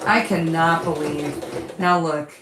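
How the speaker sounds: usual speaking voice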